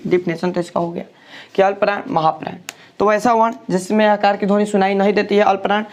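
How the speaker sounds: normal talking voice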